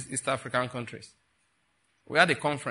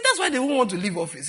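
laughing retelling; 9 semitones higher, 5 dB louder than usual. Retold or imitated. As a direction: quote bubbling with laughter, raised and louder. Retelling guest's joke, laughing.

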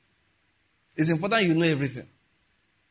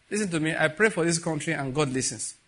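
ordinary talk, nothing marked

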